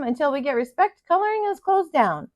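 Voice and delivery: a hint of the squeaky crayon still standing firm; stubborn crayon. Brown sets terms for the children, lightly voiced and defiant. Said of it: "Brown told him".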